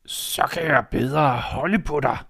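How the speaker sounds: a predator pretending to be granny; wolf in disguise. Wolf in bed answering Red, slow and sly.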